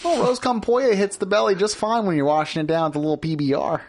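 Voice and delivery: high, bright-voiced hammed-up Wisconsin waiter; mock Wisconsin server pitching food; Wisconsin accent. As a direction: sell the burrito in a thick Wisconsin accent. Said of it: "hit your old Wisconsin accent".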